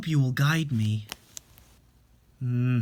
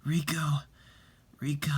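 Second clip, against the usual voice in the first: weak whisper; 2 semitones higher, 5 dB quieter than usual. Acted. Dying father calls daughter weakly.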